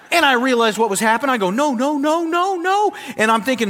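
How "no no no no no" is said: mock panic. Pleading with the referee.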